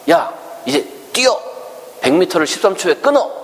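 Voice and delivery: put-on high, pushy father barking orders at a toddler; demanding father. Father pushing his toddler, high demanding shout. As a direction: shout the impossible order up high like a harsh dad.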